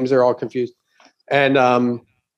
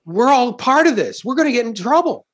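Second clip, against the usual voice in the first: panicked crowd; 11 semitones higher, faster than usual. Retold or imitated. Comic voicing the panicked crowd, mock fear. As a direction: play the frightened audience, hammed-up. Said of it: "They think".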